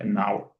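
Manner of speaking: "an hour" run together, silent h; linked pronunciation. Shown as a demonstration. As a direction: say "an hour" as "a-NOW-er", the n gliding into the vowel. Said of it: "la prononciation donne".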